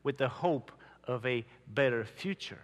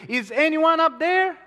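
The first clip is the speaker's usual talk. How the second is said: a desperate, much higher and louder cry for help; 12 semitones higher, 8 dB louder than usual. Acted. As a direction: yell upward from a cliff, panicking. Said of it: "he cried desperately".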